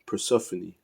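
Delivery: Persephone said per-SEF-uh-nee; settled pronunciation. Shown as a demonstration. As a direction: sound the final "nee" in Persephone. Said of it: "I believe that's how you".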